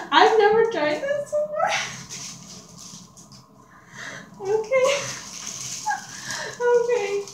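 squeaky high voice; helium voice. Real, not acted. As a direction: chirp it like a helium balloon. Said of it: "I sound like a five-year-old".

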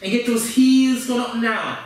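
usual speaking voice

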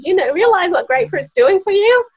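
excited question. Retold or imitated. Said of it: "I said".

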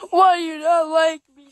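high, tearful whining plea; crying character. Acted. Crybaby Outlet pleads to be liked, mock crying. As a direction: wail it as a hammed-up crybaby.

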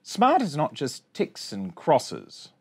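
ordinary delivery

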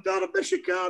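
put-on high, whiny child's wail; lightly mocking his tearful son; imitating crying son. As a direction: mimic a weeping little boy. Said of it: "he's crying".

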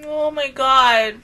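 slow, tearful oh my God; crying. Real, not acted. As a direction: let the tears come through slowly. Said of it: "I'm crying already".

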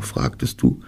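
ordinary delivery, nothing marked

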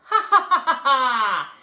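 loud gleeful cackle; big mischievous laugh; leprechaun cackle. Acted. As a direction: cackle at the silly trap.

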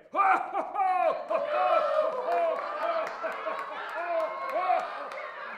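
big loud show-off cackle; hammy laugh. Acted. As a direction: howl with laughter at your own joke. Laughs at his own joke.

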